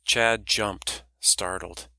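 ordinary delivery, nothing marked

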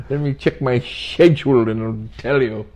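mock classically trained English actor's accent. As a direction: put on a grand English thespian accent. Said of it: "speak with an English accent".

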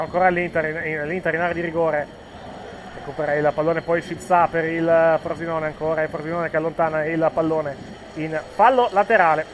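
ordinary talk

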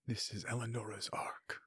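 breathy stage whisper. Real, not acted. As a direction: whisper it as an aside. Aside whispered to the table.

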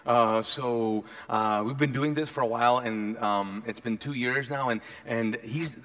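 low, soft, hesitant small talk; sheepish king. Acted. King to the prophet he threw in a well, sheepish and halting. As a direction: ease awkwardly into asking, low and quiet.

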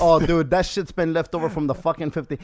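ordinary delivery

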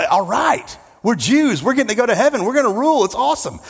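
high-pitched excited crowd reply; gleeful cheering. Acted.

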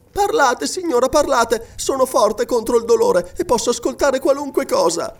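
put-on insistent girl, pitched high; pleading girl. A narrator's reading. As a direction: urge her on in a high young voice.

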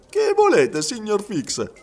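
high, loud character voice; excitable Frenchman. A narrator's reading. Passepartout to detective Fix who holds him back, excitable. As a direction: pipe up sharply as an excitable servant.

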